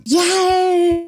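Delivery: high, drawn-out cheer; celebratory squeal of relief; gleeful cheer. Real, not acted. Cheers being free of episodes.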